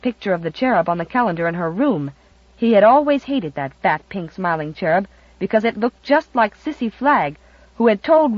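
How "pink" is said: ordinary delivery, nothing marked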